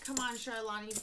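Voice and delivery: normal talking voice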